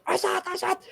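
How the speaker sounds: very high squeaky calling of his name; eager calling. Acted. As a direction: call out to him as you scramble up, excited. Little eel scampers up calling him.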